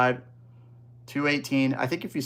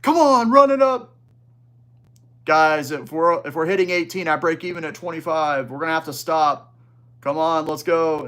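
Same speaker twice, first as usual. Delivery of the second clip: mock a yelling stream host working the crowd; imitated yelling; 4 semitones higher, 7 dB louder than usual. App streamer hyping viewers to keep buying, mock yelling. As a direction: hype streamer yell, loud and high. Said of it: "when they're, like, yelling".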